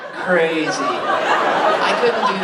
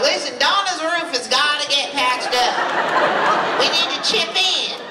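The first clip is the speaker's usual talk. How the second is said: slow, higher, mock-earnest town hall plea; mock town meeting voice; 9 semitones higher than usual. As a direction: play the neighbour rallying the town to chip in. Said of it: "I'm like".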